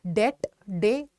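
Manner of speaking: डेट drawn out very slowly, then just डे; slow careful pronunciation. Shown as a demonstration. Teacher slowly modelling डेट for the class, drawn out. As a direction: stretch out डेट, det, syllable by syllable. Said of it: "B को आप ना ऐसे मानो कि है ही नहीं".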